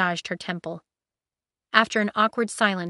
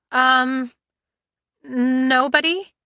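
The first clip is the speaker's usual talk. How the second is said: hesitant, slow; stammering; 6 semitones higher, 8 dB louder, much slower than usual. Acted. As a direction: stumble over it nervously. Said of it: "she stammered".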